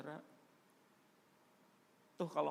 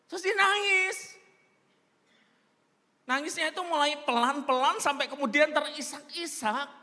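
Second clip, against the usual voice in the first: dramatic telling; 13 semitones higher, 11 dB louder than usual. A narrator's reading. Dramatic retelling of crying.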